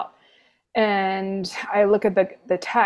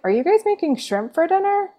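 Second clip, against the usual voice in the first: confused high question; 10 semitones higher, faster than usual. Retold or imitated. Confused self in retold story.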